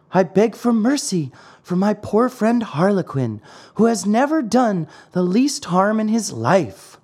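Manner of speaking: high, earnest puppet voice; small high-voiced marionette begging; pleading Pinocchio. A narrator's reading. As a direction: plead for a friend, pitched high. Pinocchio pleads for his friend.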